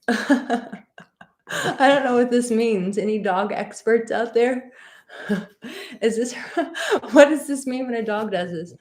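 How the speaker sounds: high, giggling voice asking about the dog. Real, not acted. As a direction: laugh through the words at the dog. Dog acting strange on camera.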